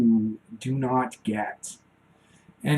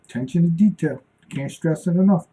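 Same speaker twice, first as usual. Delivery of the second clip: drill sergeant motto; 6 semitones higher, 6 dB louder than usual. Retold or imitated. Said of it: "He would say".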